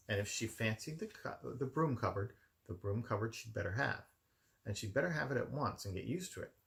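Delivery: plain reading voice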